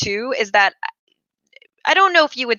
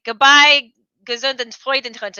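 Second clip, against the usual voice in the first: put-on playful German-accented farewell; grandma's German farewell; 3 semitones higher, 4 dB louder than usual. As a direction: quote grandma's German parting phrase fondly. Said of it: "as my grandma would say".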